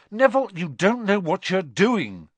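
high, loud exasperated plea. A narrator's reading. Ron pleading with Neville, exasperated. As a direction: reason with him, out of patience. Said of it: "said Ron in exasperation".

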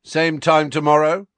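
high, loud shout to the gang; calling to mates. A narrator's reading. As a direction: call it out as you part. Bully calling to departing gang.

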